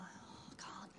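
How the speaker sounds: breathless whispered refusal; scared whisper. Retold or imitated. Jane whispering to God that she can't. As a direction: barely voice it, shrinking back. Shrinking from God's request.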